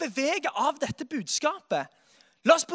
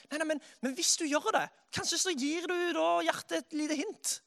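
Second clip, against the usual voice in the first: ordinary delivery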